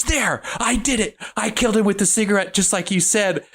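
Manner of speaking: high, theatrical announcement to the camera; mock-triumphant high voice; hammed-up Lawrence. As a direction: play it big and fake-proud for the hidden watcher. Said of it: "Lawrence turns to the camera and goes".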